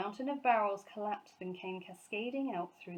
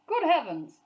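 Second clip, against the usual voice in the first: much louder, higher startled boom; booming exclamation; 8 semitones higher, 11 dB louder than usual. A narrator's reading. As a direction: boom it out in shock. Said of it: "boomed Sir Huntley".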